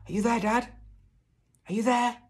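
very high, loud searching call; shouting. A narrator's reading. Boy calling into the wood for his dad, shouting. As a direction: holler to someone out of sight. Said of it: "I shouted".

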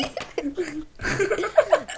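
real laughing fit. Real, not acted. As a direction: laugh helplessly. Laughs at friend's yell.

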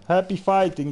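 ordinary talk

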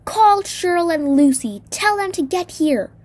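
loud, high-pitched urgent yell; shrieked order. A narrator's reading. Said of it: "I shrieked".

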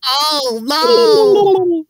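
very high shrieked "oh no"; mock dismay.

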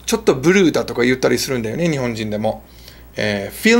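normal talking voice

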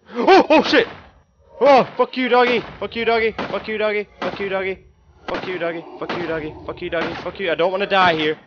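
high panicked screaming at attackers; panicking gamer. Real, not acted. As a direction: yell in full panic, fading out. Chased by dogs in game.

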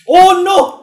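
loud, high-pitched yell of dismay; alarmed cry. Real, not acted. Player seeing danger behind, alarmed cry.

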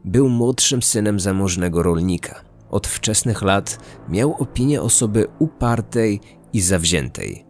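ordinary delivery

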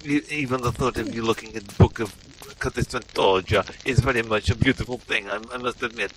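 put-on broken English in a heavy Russian-style accent; bewildered Russian-accented gamer; mock Eastern European accent.

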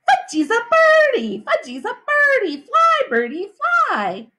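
put-on high, crowing little-kid shout; excited toddler. A narrator's reading. Little brother flapping on the jungle gym, high excited shout. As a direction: crow it like a small child playing bird. Toddler playing bird, about to jump.

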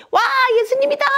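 very high, loud excited cry; excited crowd cheer. Acted. Storyteller voices the crowd spotting Jesus, thrilled high shout.

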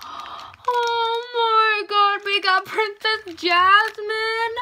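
high, drawn-out squeal of delight; thrilled gasp-and-wail over a favorite toy; overjoyed. Real, not acted. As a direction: gush slowly at a pitch near squealing. Gets favorite princess in toy opening.

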